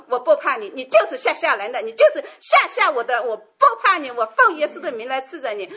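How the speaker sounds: loud, forceful retold rebuke of a demon; defiant rebuke. Telling how she rebuked the night demon, loud defiant rebuke. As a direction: stand your ground and drive it out.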